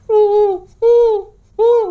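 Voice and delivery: high, wailing hum as if crying; possessed moaning. Retold or imitated. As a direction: moan like someone possessed, high and tearful. Possessed sister-in-law moaning in car. (rintihan tinggi seperti kesurupan)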